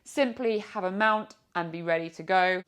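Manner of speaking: ordinary talk, nothing marked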